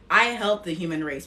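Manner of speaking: ordinary talk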